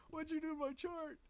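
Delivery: high-pitched comic outrage; Grant's mock dismay. Bandleader imagining the arranger's return, high mock dismay. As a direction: squeal it in mock protest, close to laughing. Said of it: "when Grant comes back, he'll be like".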